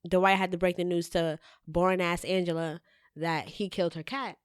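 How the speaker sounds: normal talking voice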